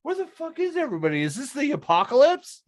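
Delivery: high, fast, baffled; high-pitched, rushed disbelief at an empty city; mock bewilderment. Empty freeway in Seattle.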